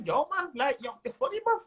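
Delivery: put-on Birmingham accent. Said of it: "I hear the accent".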